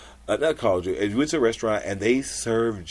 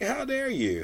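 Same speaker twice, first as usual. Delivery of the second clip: mock offended; 5 dB quieter than usual. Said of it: "and so I'm like".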